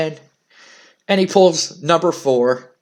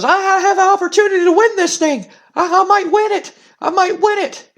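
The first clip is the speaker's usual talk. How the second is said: much higher, fast giddy voice; excited; 14 semitones higher, faster than usual. Retold or imitated. Dwayne Gill celebrating drawing number thirty, high giddy excitement. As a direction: squeal with disbelieving joy. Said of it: "and he goes".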